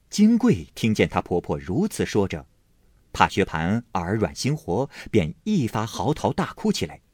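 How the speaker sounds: plain reading voice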